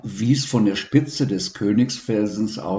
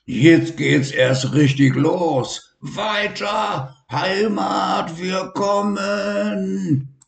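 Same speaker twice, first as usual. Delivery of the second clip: excited call; 11 semitones higher, slower than usual. Acted. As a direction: urge on the ride with glee. Lion shouts off on wild ride. (aufgeregter, hoher Anfeuerungsruf)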